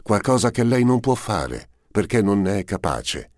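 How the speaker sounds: a hint of mocking contempt in the voice; bitter irony. A narrator's reading. Duchess answers the prince with lightly voiced bitter irony. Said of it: "con un'ironia amara".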